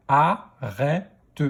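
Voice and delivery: "Arrête" split into a, rè, te; "Arrête" in three separate beats; syllable by syllable. Shown as a demonstration. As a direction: sound out "Arrête" with a final te. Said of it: "Deuxième syllabe".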